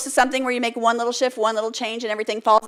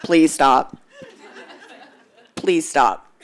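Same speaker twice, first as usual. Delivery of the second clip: lower, pained plea with a laugh; awkward male response; 5 semitones lower, 7 dB louder, much slower than usual. Retold or imitated. A male officer to a crying woman, lower awkward plea. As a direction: beg her to stop, uncomfortable. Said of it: "It looks like this".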